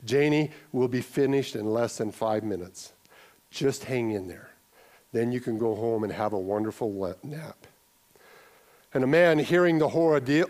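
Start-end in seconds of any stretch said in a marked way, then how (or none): 0.0-7.7 gentle encouragement. A narrator's reading. Mother reassuring at the checkout stand, soft and patient. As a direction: lower and softer, patient reassuring mom. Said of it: "with encouragement and gentleness, said".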